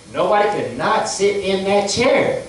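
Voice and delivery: ordinary talk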